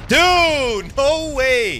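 high, loud shocked gasp of a line; can't believe what just happened; stunned disbelief. Real, not acted. Gamer shocked by an unexpected play, high disbelief.

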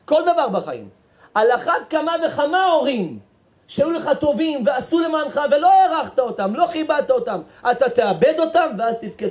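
high, loud preacher's lament; impassioned preaching. Acted. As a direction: drive it home from the pulpit. Preaches at full force.

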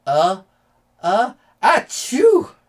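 rising build-up to a big loud achoo; hammed-up storybook sneeze; acted sneeze. A narrator's reading. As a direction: wind up slowly, then explode in a sneeze. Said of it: "come out with a sneeze".

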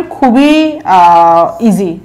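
normal talking voice

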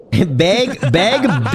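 "Beg" as a mock take on "big"; mimicked pronunciation. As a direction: echo "big" teasingly as "Beg". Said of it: "big or bag?".

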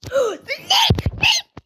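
high rising shouted gasp; sharp high gasp of alarm; loud shocked gasp. Real, not acted. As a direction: gasp out loud at the near miss. Near miss in the match.

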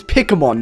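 "Pokemon" turned into "PICK-uh-mon"; mispronounced Pokemon. Retold or imitated. Streamer explains the blended name, "Pokemon" said as "pickamon". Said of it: "combined the two together".

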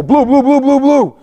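high loud repeated call of blue; yelling. Retold or imitated. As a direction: holler it to get them moving. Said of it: "yelling at the guys just like that".

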